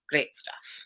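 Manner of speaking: softly imitated poet, "Great stuff" kept low in volume. Reader recalls a poet's recorded poem, soft imitation. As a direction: quote the poet's catchphrase very softly. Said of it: "And in one of them, he says".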